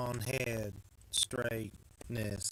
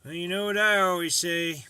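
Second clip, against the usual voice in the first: mock wisdom; 5 semitones higher, 9 dB louder than usual. Maker telling viewers his saying, mock-proud motto. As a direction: deliver a joke proverb like a proud maxim.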